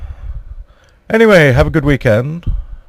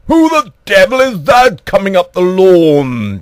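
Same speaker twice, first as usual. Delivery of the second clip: high, slow, drawn-out outrage; hammed-up indignant queen; 6 semitones higher, 4 dB louder than usual. Acted indignant Queen spotting intruder.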